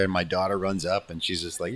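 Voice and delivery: ordinary talk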